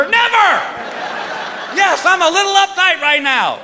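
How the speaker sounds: high-pitched comic yell; acted uptight outburst. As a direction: blurt it out in mock panic. Acted outburst about insertion.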